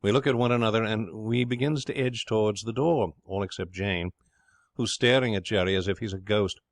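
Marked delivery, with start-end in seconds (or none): none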